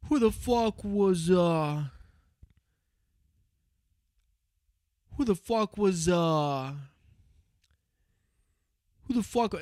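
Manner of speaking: Italian gangster losing his words on shrooms; stuttering Italian mob boss. Retold or imitated. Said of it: "he starts doing this Italian accent".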